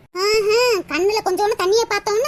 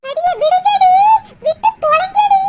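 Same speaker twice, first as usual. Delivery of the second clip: much higher, louder scream to let go; desperate scream; 9 semitones higher, 6 dB louder than usual. Acted. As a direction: shriek at them to stop. Screaming to break up fight. (பதறிக் கத்துதல்)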